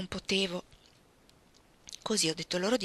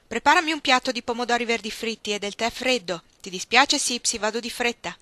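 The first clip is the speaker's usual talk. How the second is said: higher, louder call into the kitchen; shouted order; 4 semitones higher, 6 dB louder than usual. A narrator's reading. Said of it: "alla porta della cucina e gridò".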